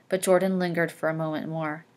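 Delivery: ordinary talk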